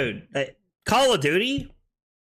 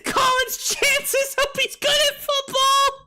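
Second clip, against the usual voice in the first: high, squeaky voice through laughter; crying with laughter at the joke; laughing hard. Real, not acted. Cracking up at Kyle's joke.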